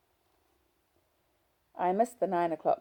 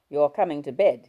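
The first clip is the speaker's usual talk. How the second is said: louder, jaw-clenched order; husband ordering his wife to bed through his teeth, louder; 13 dB louder than usual. A narrator's reading. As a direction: force it out through gritted teeth. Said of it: "he said through his teeth".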